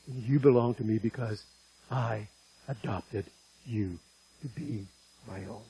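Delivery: soft, hushed words of a loving father; quiet, tender voice of God to his child. Acted. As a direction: reassure him gently, like a father to his son.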